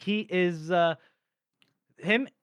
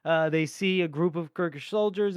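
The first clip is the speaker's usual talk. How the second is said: ordinary talk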